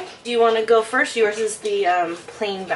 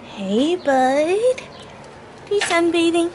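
talking to the dog; 9 semitones higher, slower than usual. Real, not acted. Greeting the dog.

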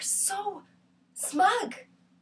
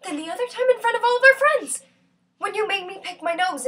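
high, loud outburst of grievance; loud, high-pitched indignation; heated accusation; 6 semitones higher, 3 dB louder than usual. Acted. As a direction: raise the voice sharply as you accuse. Acted accusation, recalling humiliation.

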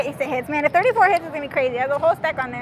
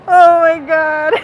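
slow, louder, tearful oh my God; crying with joy; 8 dB louder, slower than usual. Real, not acted. Player overwhelmed by her win, tearful. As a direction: sob it out happily.

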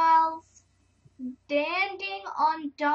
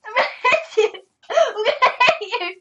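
laughing threat; 8 semitones higher, 12 dB louder than usual. Real, not acted. Playful threat in fight, laughing.

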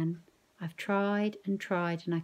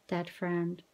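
usual speaking voice

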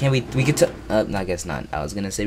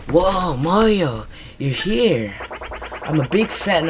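starstruck fan; 6 semitones higher, slower than usual. Acted. Starstruck fan meeting Mario.

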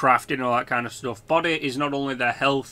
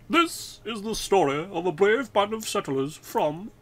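higher, refined storyteller voice; posh person voice; 6 semitones higher than usual. Shown as a demonstration. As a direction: narrate like a posh announcer. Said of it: "let me put on my posh person, person voice".

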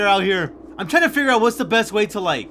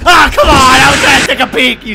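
much louder, higher outburst; angry shout; 4 semitones higher, 10 dB louder than usual. Real, not acted. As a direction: yell at the monster. Caught peeking, yelling.

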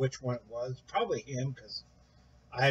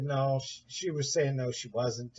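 ordinary talk, nothing marked

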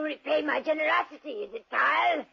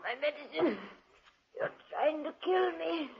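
weak gasp; 6 dB quieter than usual. Acted. Dying woman pleads weakly.

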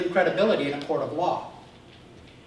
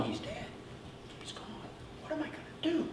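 grieving; 10 semitones higher, 16 dB quieter than usual. Acted. Mary grieving at the tomb.

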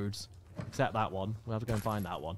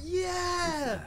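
excited cheer; 14 semitones higher, 5 dB quieter than usual. Real, not acted.

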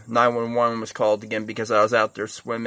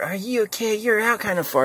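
mocking concern; 9 semitones higher than usual. Mocking the paddleboarders again.

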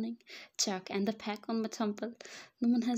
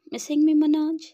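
high, loud playful taunt; teasing; 6 semitones higher, 12 dB louder than usual. Acted. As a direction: tease them with a raised, lilting question. Husband's teasing text, read out.

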